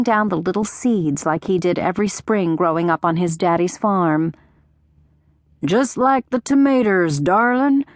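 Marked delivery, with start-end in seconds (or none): 5.6-7.8 higher, hammed-up Southern country accent; exaggerated country accent. A narrator's reading. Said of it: "in his exaggerated country accent".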